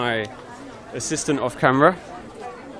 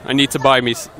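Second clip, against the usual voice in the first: usual speaking voice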